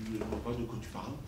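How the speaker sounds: husband feigning innocence, hushed and fast; sheepish denial. Retold or imitated. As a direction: play dumb under your breath. Innocent denial after wife's outburst.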